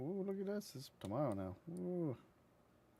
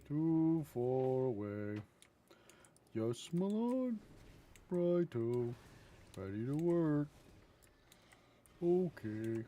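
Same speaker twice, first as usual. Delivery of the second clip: louder, put-on game character replies; drawn-out, higher mock servant lines; mimicking game units; 5 semitones higher, 5 dB louder, slower than usual. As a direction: parrot stock unit responses theatrically.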